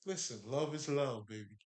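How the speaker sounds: ordinary talk, nothing marked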